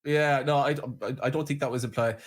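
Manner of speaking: ordinary talk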